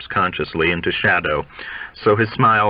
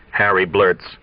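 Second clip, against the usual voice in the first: usual speaking voice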